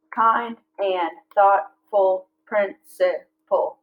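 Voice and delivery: very slow, beat by beat; slow syllable-counting read; counting syllables aloud. Shown as a demonstration. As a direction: count each syllable as you say it. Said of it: "that's eight syllables".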